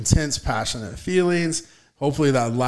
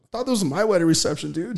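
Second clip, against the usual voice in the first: usual speaking voice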